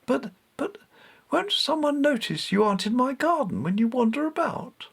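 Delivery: put-on boy still anxious, questioning Bastet; stammering worried boy. A narrator's reading. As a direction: stumble over the words in worry. Richard stammers in worry.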